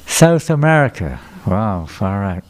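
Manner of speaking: normal talking voice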